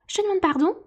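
high, stiffly polite mouse voice; polite on the surface, annoyed beneath; polite but frowning mouse. A narrator's reading. Mouse questions the lory, high and stiffly polite. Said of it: "très poliment mais en fronçant les sourcils".

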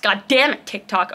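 ordinary talk, nothing marked

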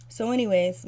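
ordinary delivery

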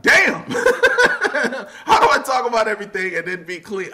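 high, loud, laughing through the words; laughing disbelief. Retold or imitated. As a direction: crack up at your own dilemma. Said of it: "I was like".

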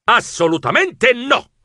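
huffy refusal. A narrator's reading. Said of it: "Io sbuffai".

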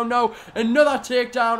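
ordinary delivery

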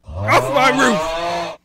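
loud drawn-out yell; shouting. Real, not acted. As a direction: bellow it out slowly. Shoving a man off roof.

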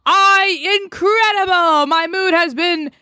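high, over-the-top mock enthusiasm; sarcastic delight. Frustrated player praising how easy this is, sarcastic gushing. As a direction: gush loudly, hammed-up and insincere. Sarcastic joy at being refused.

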